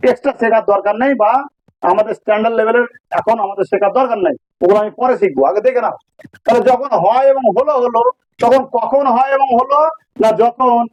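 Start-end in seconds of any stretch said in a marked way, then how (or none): none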